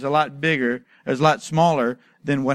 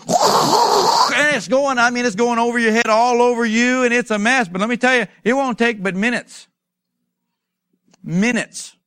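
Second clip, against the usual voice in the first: raised pitch, excited over the roaring hose; worked-up preaching; 6 semitones higher, 4 dB louder than usual. Acted. Preacher describing a hose spraying everywhere, worked up. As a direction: talk high over an imagined blast of water. Acts out struggling with fire hose.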